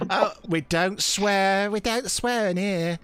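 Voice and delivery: high, slow gentle reproof; scolding old woman. Acted. Old woman tells visitor off for swearing, slow reproof. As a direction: chide like a prim old lady. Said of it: "And she goes".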